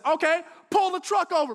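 high, loud shouted command; parent's yelled order. Retold or imitated. Mom to dad who's driving, yelled order. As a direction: shout the order across the truck. Acted parents' quarrel in truck.

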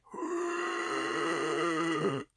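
high, strained gasping breath in; demo gasp. Shown as a demonstration. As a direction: show the rattly struggling inhale. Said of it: "it literally sounds like I'm dying".

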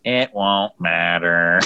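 very slow, low droid reply; mock deadpan droid. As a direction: voice the doomed droid flatly. Quoting the other droid's reply.

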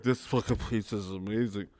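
put-on low, soft drunken slur. Drunk customer praising pizza, low drunken voice. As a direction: imitate a drunk savoring food. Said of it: "but when you're drunk".

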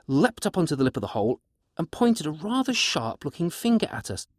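ordinary delivery, nothing marked

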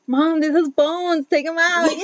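half-laughing impression of her son; imitating son. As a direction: lightly mimic the teen asking mom to fix it. Imitating teenage son complaining.

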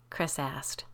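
usual speaking voice

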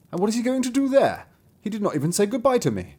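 a father feeling slighted; hurt father. Acted.

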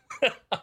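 short high chuckle; amused burst of laughter; real laugh. Real, not acted. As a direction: laugh at your own joke. Laughs at his own flame-broiled joke.

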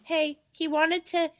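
high, soft, slow imitation of a girl; sweet girl voice. Host imitates the girl telling on the tutor, sweet voice. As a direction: coo it slowly in a sweet, small voice. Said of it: "a sweet voice".

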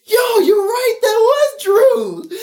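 high squeaky laughing voice. Retold or imitated. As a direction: laugh through the words. Quoting friend, laughing.

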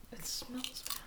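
disgusted whisper. Real, not acted. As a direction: breathe it out in disgust. Sniffs the candy, disgusted.

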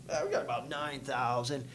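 low, soft, unbothered; lightly imitating a calm man, quiet; calm as can be. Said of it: "he says it like he's just calm".

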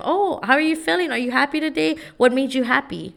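high, sweet-talking parent voice; parent checking in. Shown as a demonstration. Parent asking a child about their feelings, high and gentle.

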